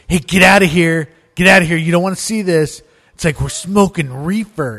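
loud, pushy "get outta here"; pushy stoner shooing him off. Retold or imitated. Bandmate shooing away the newcomer, loud and pushy. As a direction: shoo him away loudly, half laughing. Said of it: "He goes".

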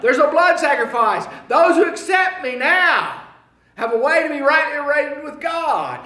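loud, high shouting sermon; triumphant preaching. Acted. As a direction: proclaim victory to the crowd.

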